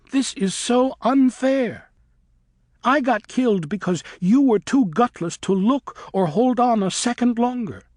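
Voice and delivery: put-on girl bat raging over her own death; upset outburst. A narrator's reading. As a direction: cry it out as an injustice. Luna blaming Griffin for her death.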